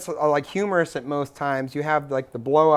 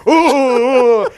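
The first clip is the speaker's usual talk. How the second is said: high, loud hooted "oh"s; imitating panicked hoots; mock flustered ohs; 6 semitones higher, 7 dB louder, much slower than usual. Friend acting out men jumping out of bed, mock flustered ohs.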